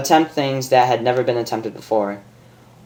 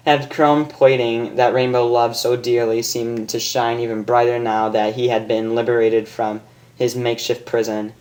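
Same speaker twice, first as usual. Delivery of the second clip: ordinary delivery, nothing marked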